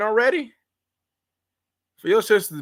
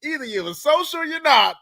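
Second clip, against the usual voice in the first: ordinary talk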